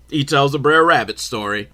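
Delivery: normal talking voice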